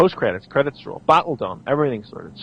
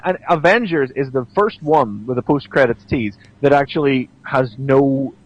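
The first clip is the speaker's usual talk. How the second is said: normal talking voice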